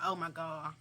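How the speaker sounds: low, soft mock dismay; acted weary aside; muted dismay. Acted weary reaction to yanking.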